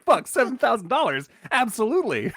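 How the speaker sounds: high, laughing greedy excitement; imitating a man thrilled by cash; hammed-up giddy yes to money; gleeful mock greed. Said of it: "He's just like".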